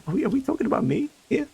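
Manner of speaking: very high, quick squeak; fast squeaky voice. Pretend. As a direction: rattle it off way up high. Repeats the question, more shrill.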